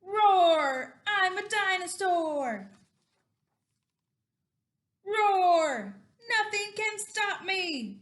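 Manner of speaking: loud, high, roaring little dinosaur; boastful dinosaur. Acted. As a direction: roar it out like an unstoppable dinosaur. Voices the boastful dinosaur hero.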